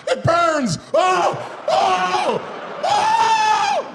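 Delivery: very high, loud agonized screams; freaking out. Acted. Hit passenger in agony, rising shrieks. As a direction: shriek in hammed-up burning agony. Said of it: "This guy was freaking out".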